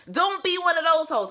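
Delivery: high, loud punchline; loud warning. Real, not acted. Vlogger warning viewers, loud and high. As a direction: shout it as a final jab.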